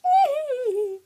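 high cackling villain laugh; evil laugh. Acted. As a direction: cackle like a wicked witch. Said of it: "do an evil laugh after every sentence".

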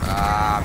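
frustrated yell. Real, not acted.

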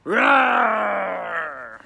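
loud, long, high monster roar falling away; bear roar. Acted. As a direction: roar like a beast at the window. Bear roars.

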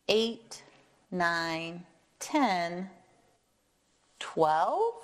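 ordinary delivery

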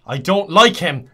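slow, loud, insistent complaint. Real, not acted. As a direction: say it slowly and loudly to be heard. Repeats complaint, much louder.

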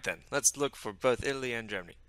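normal talking voice

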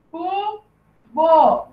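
"Cubo" pulled apart, cu, long pause, bo; syllable by syllable. Shown as a demonstration. Teacher repeating for the class to copy, "Cubo" syllable by syllable. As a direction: leave a clear gap inside "Cubo". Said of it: "a sílaba bo".

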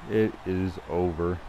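"It is over" slowly, word by word; slow, emphatic. Real, not acted. Gamer declaring the game finished, slow and emphatic. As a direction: spell out "is" in full, unhurried.

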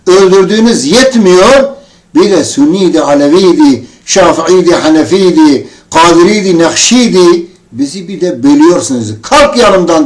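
loud reenacted tirade, pounding through the list; forceful, indignant retold yelling; angry shouting. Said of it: "avazım çıktığı kadar bağırdım üstüne".

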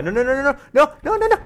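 rising panicked high no no no; real alarm as the room floods, pitch climbing. Real, not acted. Player watching water flood the room, panicked high cries.

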